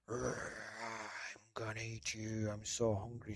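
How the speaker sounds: a hint of bear, soft and slow; hungry bear. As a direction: rumble it slowly. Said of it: "said the bear".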